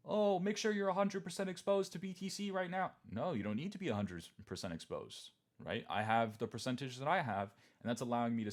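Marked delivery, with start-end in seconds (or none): 0.1-2.9 high sing-song mock advice; hammed-up shill telling everyone to go all in. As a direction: parody a pushy crypto shill. Mocking crypto Twitter advice.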